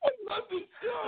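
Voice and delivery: laughing. Real, not acted. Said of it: "Who's crying? I'm laughing".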